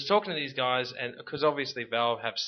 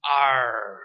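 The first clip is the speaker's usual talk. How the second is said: pirate growl; 7 dB louder than usual. Pretend. Joking about pirates.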